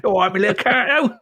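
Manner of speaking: cheeky Cockney greeting through laughter; mock Cockney. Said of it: "sounds like a Cockney welcome".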